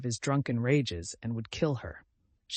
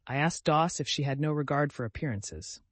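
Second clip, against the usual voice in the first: usual speaking voice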